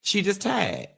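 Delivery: high, drawn Miami voice; Miami accent. Pretend. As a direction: sing it out Miami-style, high. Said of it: "In my best Miami voice".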